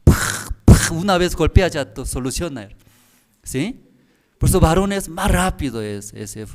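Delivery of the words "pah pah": loud sharp pah; punch sound. Retold or imitated. Preacher imitating a husband's punch. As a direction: smack it out like a blow. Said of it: "El puño más rápido".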